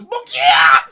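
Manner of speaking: very loud imitated attack cry; explosive battle yell; impact yell. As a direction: burst out as the blow lands. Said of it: "And he just goes".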